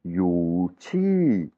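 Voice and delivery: "yu chi" drawn out, "chi" arched then falling. Shown as a demonstration. Teacher isolates it for students, falling tone on "chi". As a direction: stretch "chi" and let it fall. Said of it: "il tombe".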